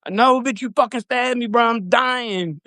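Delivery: high, frantic pleading; panicked insistence. Retold or imitated. Panicked reply to her denial.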